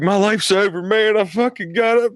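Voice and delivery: put-on high, whiny voice of a heartbroken kid; mock sobbing guy. Mimicking the dumped student, high and whiny. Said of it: "He's like".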